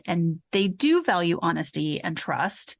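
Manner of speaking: ordinary delivery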